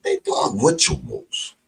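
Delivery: ordinary delivery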